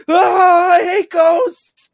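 high, loud, drawn-out wail of terror; hammed-up terrified shriek; mock scream. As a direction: scream it out, stretching the last word. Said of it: "he just starts screaming".

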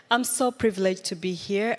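ordinary delivery

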